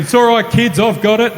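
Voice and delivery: high, loud hammed-up hero voice; mock heroic. Mocking himself as the bus hero, loud and high. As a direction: play the brave rescuer for laughs. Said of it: "I was thinking".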